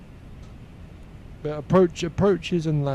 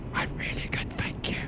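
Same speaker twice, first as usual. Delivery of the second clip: whisper; 10 dB quieter than usual. Pretend. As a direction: pretend reply in a whisper. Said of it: "You could kind of talk like this".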